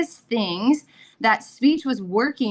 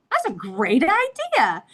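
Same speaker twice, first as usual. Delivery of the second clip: high, drawn-out swoop of enthusiasm; retold thrill at a great idea; delighted reaction; 6 semitones higher than usual. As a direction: stretch it out, pitch soaring with delight. Delighted reaction to son's suggestion.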